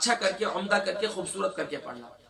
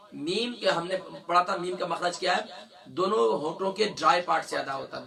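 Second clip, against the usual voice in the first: normal talking voice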